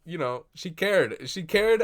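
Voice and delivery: high, loud and slowing; real rising emotion over the film; moved insistence. Real, not acted. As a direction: press it like it matters deeply. Moved by the film's ending.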